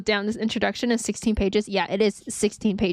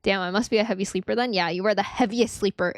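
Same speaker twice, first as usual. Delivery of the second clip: ordinary talk, nothing marked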